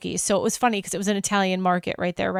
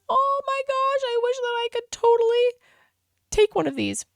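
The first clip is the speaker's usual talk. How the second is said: much higher, breathless delight; excited squeal; 13 semitones higher than usual. Retold or imitated. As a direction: gush like you found a treasure. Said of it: "I was like".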